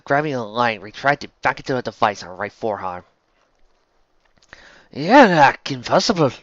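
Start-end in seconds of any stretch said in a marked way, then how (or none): 4.9-6.3 loud, slow, raised Scots voice; Scottish villain, taken aback. Acted. Villain seeing the wrong girl before him, slow disbelief. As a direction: say it slowly in puzzled disbelief. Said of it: "Killigan muttered".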